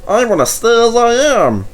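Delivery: insist, nearly crying out; loud, high, stubborn plea; high, loud protest. A narrator's reading. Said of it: "protested Bert".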